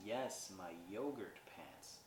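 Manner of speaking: "yogurt" said slowly with a clear final T; deliberate insistence. Retold or imitated. Noelle insisting on yogurt to the puzzled shopper, deliberate. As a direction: stretch out "yogurt" as if it were obvious.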